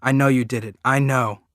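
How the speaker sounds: loud, raw shouted accusation; boy screaming at his father that he saw; accusing scream. Acted. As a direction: yell it right in his face. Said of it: "I'm going".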